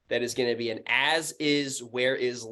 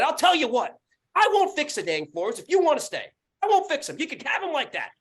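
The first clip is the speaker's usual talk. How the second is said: heated ultimatum, pitched way up; pissed off; 14 semitones higher, 4 dB louder than usual. Retold or imitated. Landlord retells refusing to fix floors, angry rant. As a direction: lay it down in a raised, angry voice. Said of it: "And now I'm fucking pissed".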